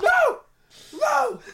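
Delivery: very high, loud squeal of no; horrified yell. Real, not acted. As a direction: shriek it in half-laughing horror. Recoiling from the injury story.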